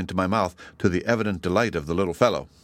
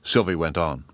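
ordinary delivery

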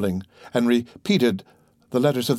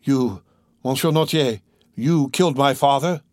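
raised, incredulous accusation; shocked cry; 3 semitones higher than usual. Acted. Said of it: "cried Franz".

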